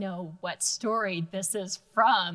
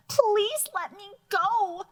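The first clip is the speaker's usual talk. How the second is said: much higher, slow, pleading squeak; frightened mouse; 12 semitones higher, 3 dB louder, slower than usual. Acted. Librarian voicing the begging mouse from the fairy tale. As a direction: beg like a terrified little mouse. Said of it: "I love your voices".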